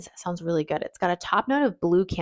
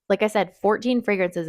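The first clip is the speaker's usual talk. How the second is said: normal talking voice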